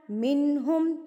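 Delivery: normal talking voice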